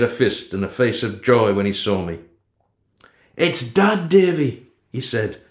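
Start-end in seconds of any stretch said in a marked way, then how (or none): 3.4-4.7 breathless thrilled whisper; excited whisper. A narrator's reading. Boy whispering to his friend that his dead dad is back, thrilled. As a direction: whisper it, bursting with joy.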